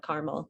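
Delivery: "caramel" as CAR-mul; regional two-syllable caramel. Shown as a demonstration. Telling how home region says it, "caramel" as CAR-mul. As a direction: drop the middle vowel of "caramel": KAR-mul. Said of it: "because of where I'm from".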